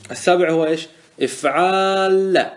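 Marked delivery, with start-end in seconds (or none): 1.2-2.5 افعال with the middle aa held very long, then a doubled lam; long drawn-out alif. Shown as a demonstration. Teacher models the seventh pattern, long drawn-out aa in افعال. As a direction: stretch the alif in افعال, level and sustained. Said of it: "هذا بالألف".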